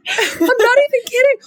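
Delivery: half laughing, half crying the words out. Real, not acted. Host laughing at her own birthday story.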